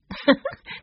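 short high-pitched giggle; light laugh. A narrator's reading. Violet amused by her brother's answer, laughing. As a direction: give a little high laugh. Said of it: "Violet laughed".

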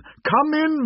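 high booming welcome; cheerful spirit. A narrator's reading. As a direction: repeat the welcome even bigger. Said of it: "called out cheerfully".

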